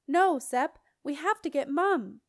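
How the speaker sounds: loud, high urgent yell. A narrator's reading. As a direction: yell urgently into his ear. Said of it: "yelled in Septimus's ear".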